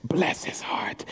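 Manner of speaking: slow, pitying stage whisper; hushed imitation of condescending churchgoers. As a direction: whisper it to yourselves behind someone's back. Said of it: "they're whispering to themselves".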